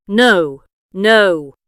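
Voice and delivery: firm denial. A narrator's reading. Jack denying he'll murder.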